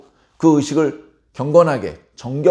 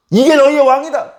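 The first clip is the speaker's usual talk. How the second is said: loud, high, derisive proclamation; mocking shout; 10 semitones higher, 6 dB louder than usual. Pilate presents the beaten prisoner to the crowd, mocking shout. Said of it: "비꼬는 듯한 그런 표현을".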